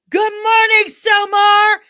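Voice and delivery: high, loud sing-out hello; big loud high-pitched greeting; shouted greeting. Real, not acted. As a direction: holler good morning to someone arriving. Calling out greeting to a viewer.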